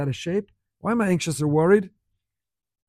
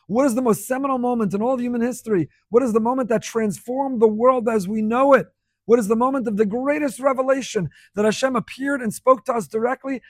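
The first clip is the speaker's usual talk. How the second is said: raised, higher preacher's voice, building; impassioned preaching; 5 semitones higher, 4 dB louder than usual. Real, not acted. Preacher leading listeners back to Sinai, impassioned and building. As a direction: build the questions to a forceful peak.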